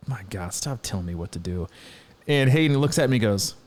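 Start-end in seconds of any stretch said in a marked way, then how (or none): 0.1-1.7 low, very soft grumble; under his breath. Retold or imitated. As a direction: grumble it privately, well under full voice. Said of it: "what you say under your breath".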